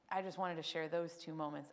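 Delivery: ordinary talk, nothing marked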